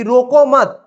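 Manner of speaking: रोको मत joined as one loud command; pause after मत. Shown as a demonstration. Teacher demonstrating the second meaning, रोको मत together. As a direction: bind रोको to मत, then stop. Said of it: "यहां पर हम बोलेंगे कि".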